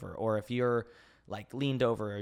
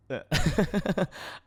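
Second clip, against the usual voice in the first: bursting laugh; 7 semitones higher, 5 dB louder than usual. Real, not acted. Laughs at own question.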